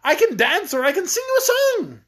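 high-pitched, desperate begging; pleading. A narrator's reading. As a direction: bargain desperately to escape tickling. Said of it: "pleaded poor Rinkitink".

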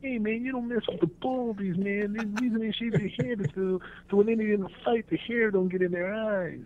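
high put-on street tough voice; movie gang member. Quoting The Wanderers to the hosts, in a high tough-guy voice. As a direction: play a swaggering gang kid from the film. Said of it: "they made the movie The Wanderers".

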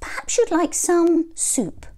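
high fluttery hen voice; sweet, high mother hen. A narrator's reading. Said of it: "squawked Mother Hen".

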